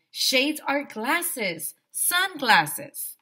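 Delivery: slow condescending explanation. Acted.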